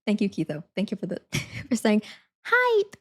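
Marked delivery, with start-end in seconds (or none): 2.4-2.9 very high squeaky "Hype"; high-pitched. Shown as a demonstration. Streamer offering viewers a second take, high-pitched. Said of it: "It was definitely the high-pitched one".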